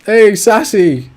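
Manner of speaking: loud drawn-out greeting; calling out. Real, not acted. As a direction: call out the name slowly. Streamer greeting new caller loudly.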